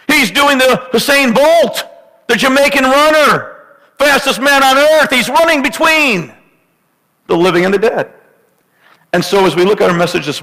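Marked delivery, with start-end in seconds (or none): 0.1-8.1 high, impassioned pulpit preaching; full-force preaching. Acted. Pastor urging congregation about Aaron, fervent high preaching. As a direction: preach it hard and high to the church.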